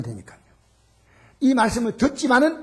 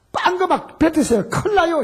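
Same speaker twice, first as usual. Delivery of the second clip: urgent scolding; 9 semitones higher, 4 dB louder than usual. Retold or imitated. Nurse scolding him on phone.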